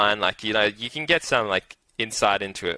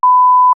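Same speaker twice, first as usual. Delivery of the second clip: loud laugh; 15 dB louder than usual. Real, not acted. Bursting out laughing while retelling the big win, loud and high. Nervous excitement over last leg.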